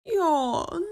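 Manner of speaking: a little duckling yawning; drowsy baby yawn. A narrator's reading. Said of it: "went the little duckling".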